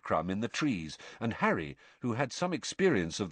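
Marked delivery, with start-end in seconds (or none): none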